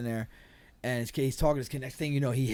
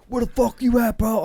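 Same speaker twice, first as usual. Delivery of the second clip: higher, louder angry call-out; retold angry shout; 10 semitones higher, 8 dB louder than usual. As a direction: call him out, pissed. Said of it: "I'm like".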